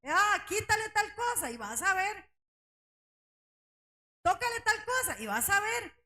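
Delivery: reto burlón, agudo y algo más fuerte; provócalo como un Satanás que se jacta. Retold or imitated. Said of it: "se regodeaba era". (Satanás desafiando a Dios con burla)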